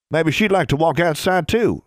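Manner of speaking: put-on high eager boyish voice; young man voice. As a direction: pitch Burton up, keen and bright.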